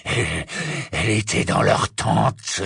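rough, rasping werewolf; raspy voice. Acted. Greyback answers the witch, raspy. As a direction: rasp it out haltingly. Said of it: "de sa voix râpeuse".

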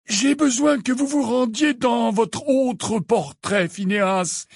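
high, slow, deliberate wizard headmaster; measured, high-pitched instruction; Dumbledore, insistent. A narrator's reading.

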